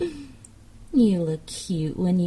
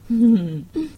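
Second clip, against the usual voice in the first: teasing laugh; 4 semitones higher, 8 dB louder than usual. Acted.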